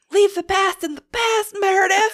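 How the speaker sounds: high, loud acted outburst; hammed-up confrontational shout; mock fight yell. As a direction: yell it like a mid-fight taunt. Acting out Whitney's snowball-fight line.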